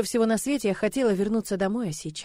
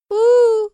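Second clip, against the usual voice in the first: high, loud whimpering howl; a little dragon wailing at the parting; dragon's sad howl; 13 semitones higher, 11 dB louder than usual. Acted. Little dragon howls sadly at Liza leaving, high, loud oo-oo-oo. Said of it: "произнесла дракошка".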